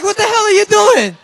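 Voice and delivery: high, loud comic outburst at a dad; exasperated shout. Retold or imitated. Exasperated son yells at dad.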